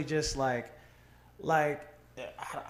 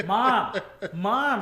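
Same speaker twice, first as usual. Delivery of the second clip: excited call, a bit higher and louder; calling mom; 4 semitones higher, 6 dB louder than usual. Retold or imitated.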